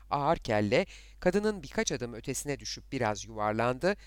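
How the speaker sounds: usual speaking voice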